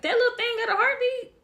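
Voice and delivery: high-pitched mock disbelief, rising; amazed question. Mother-to-be learns of a heartbeat at the ultrasound, amazed. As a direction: re-enact a stunned, squeaky question.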